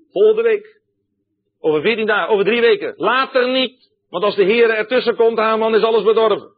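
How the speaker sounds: taunting demons. Acted. Demons promising Haman success, gloating loudly. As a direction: crow it at Haman like a tempting spirit.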